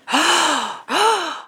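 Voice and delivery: imitated sharp gasps of shock; hammed-up, high stunned gasp. Retelling the family seeing the knife, stunned gasp. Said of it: "they're all, like, stunned".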